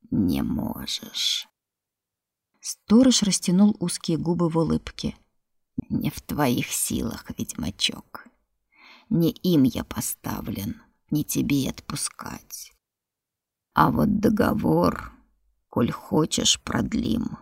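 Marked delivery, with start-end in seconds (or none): 0.1-1.5 very low, slow breathy rasp; creature voice. Acted. 5.9-17.4 creature voice. Acted. Creature speaks, low slow.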